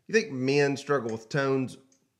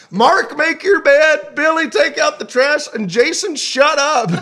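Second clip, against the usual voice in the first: higher and louder, slow bossy mom barking orders through laughter; bossy parent; 13 semitones higher, 8 dB louder than usual. Pretend. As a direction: play a fed-up mom handing out chores. Mock parent bossing the kids.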